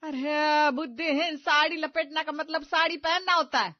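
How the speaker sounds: mother-in-law exploding at the bride; furious. Acted. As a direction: drag out the insult, loud.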